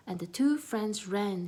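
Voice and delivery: ordinary delivery, nothing marked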